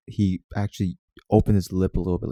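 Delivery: ordinary talk, nothing marked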